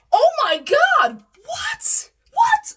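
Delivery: very high, loud mock shriek of horror; horrified. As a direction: shriek it in shocked disbelief. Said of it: "like, horrified".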